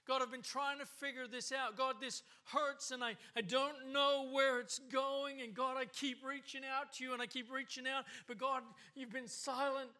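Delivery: high, loud, aching plea to God; desperate cry of a hurting believer; anguished pleading prayer. Acted. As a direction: pour it out like a broken man praying. Voicing David's anguished prayer.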